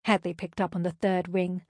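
plain reading voice

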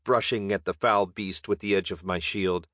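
plain reading voice

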